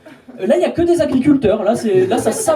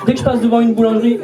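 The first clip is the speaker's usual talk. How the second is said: usual speaking voice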